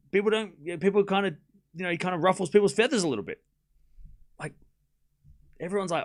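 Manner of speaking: ordinary delivery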